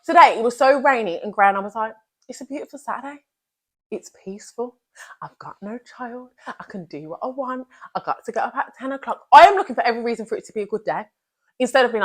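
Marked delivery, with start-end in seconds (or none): 2.3-9.3 soft self-talk. Retold or imitated. Cheerful self-talk on rainy day.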